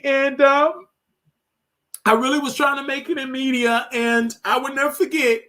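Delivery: high, strained voice holding back tears; teary. Real, not acted. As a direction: push through the tears. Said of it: "I'm just getting emotional".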